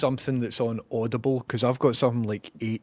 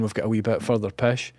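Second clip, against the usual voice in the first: ordinary delivery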